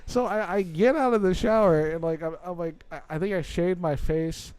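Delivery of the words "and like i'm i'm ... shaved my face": ordinary delivery, nothing marked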